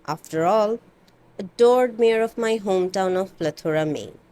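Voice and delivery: plain reading voice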